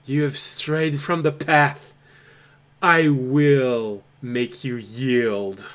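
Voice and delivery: booming voice of a stern judge; stern commanding voice. Acted. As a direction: pronounce the sentence like an implacable knight. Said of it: "You hear someone stating".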